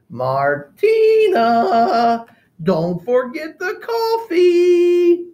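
high, slow, loud call from afar; calling grandmother. Acted. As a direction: call down sweetly from the balcony. Grandmother calls from above.

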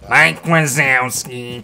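slow cartoon impression; Mike Wazowski. Shown as a demonstration. Streamer contrasting another character with his own, slow impression. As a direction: stretch it out in a cartoon voice. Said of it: "kinda sounds like this".

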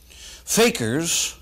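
usual speaking voice

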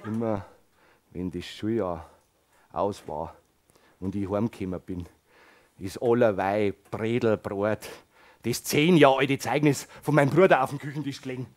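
put-on old resident telling his past; low old storyteller.

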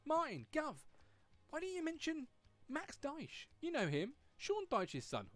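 high-pitched voice of a viewer; imagined commenter. Pretend. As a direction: pipe up high, like a viewer calling out. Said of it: "drop them in the comment section and say".

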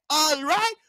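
high, questioning demand; mock-stern prompt with rising end; domineering teacher. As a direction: imitate a teacher checking the class obeys.

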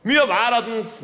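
hammed-up villager declaring the end; comic native announcing the dance is over; high mock Indio voice.

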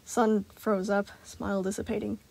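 usual speaking voice

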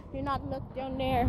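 very soft, hushed warning; hushed aside to the dog; near whisper. Real, not acted. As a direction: breathe it almost silently.

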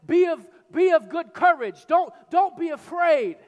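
high, loud call to be brave; rally them like Paul on deck; ringing exhortation. Acted. Paul calling to frightened sailors, high and ringing.

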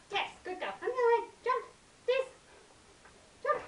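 high, squeaky praise for the dog; squeaky praising a dog. Real, not acted. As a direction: gush over the dog in a squeak.

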